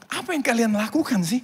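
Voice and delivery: high, puzzled demand; bewildered question. Retold or imitated. Missionary to villagers after baby exchange, bewildered high question. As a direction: ask in acted bafflement. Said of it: "Pendeta ini bingung".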